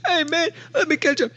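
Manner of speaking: very high, pleading character voice; Big Tim. Acted. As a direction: call after a friend in a squeaky put-on voice. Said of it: "And Big Tim's like".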